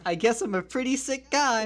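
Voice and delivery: high-pitched, mock unhinged admission; hammed-up confession. Killer confessing to his lawyer, hammed-up. Acts confession on phone message.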